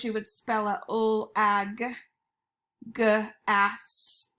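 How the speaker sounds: each sound pulled apart slowly; teacher segmenting lag and gas; sounding out letters. Shown as a demonstration. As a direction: stretch out every sound for the class. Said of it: "To spell those".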